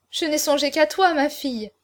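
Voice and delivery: ordinary delivery, nothing marked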